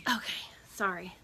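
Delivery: a quick hushed sorry under the breath; whispered aside. Real, not acted. Host whispers an apology while fixing her seat, hushed. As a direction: mutter an apology to yourself, hushed.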